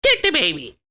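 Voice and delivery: very high, squeaky put-on voice; cartoon character voices. Practising voice acting with a cartoon line, high squeaky voice. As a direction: pitch it way up like a cartoon kid.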